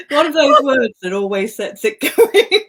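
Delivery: giggling through the words; breaking into laughter; laughing. Real, not acted. Giggling at her own lisp.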